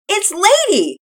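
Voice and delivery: put-on high, loud excited girl; a little girl bursting to tell; excited yell. Penelope voiced.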